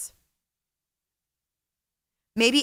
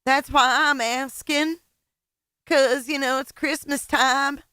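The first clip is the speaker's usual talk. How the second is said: higher, sing-song mock of the streamer; sarcastic higher mimicry of the streamer; mocking imitation; 5 semitones higher than usual. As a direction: parrot her plea sarcastically. Mocking repeat of streamer's plea.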